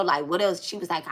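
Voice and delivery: normal talking voice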